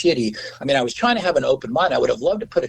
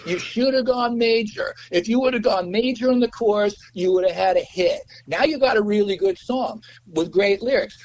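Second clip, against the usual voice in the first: pitched-up record exec giving advice; schmoozing producer; 8 semitones higher than usual. Retold or imitated. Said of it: "he goes".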